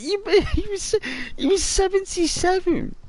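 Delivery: high-pitched, laughing through the words; laughing disbelief. Retold or imitated. Catching mum out on granddad's age, laughing and high. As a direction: laugh while you accuse her.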